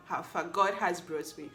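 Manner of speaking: ordinary talk, nothing marked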